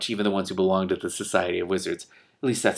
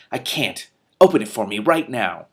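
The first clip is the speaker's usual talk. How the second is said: mad; 2 semitones higher, 7 dB louder than usual. Acted. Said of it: "She was really mad".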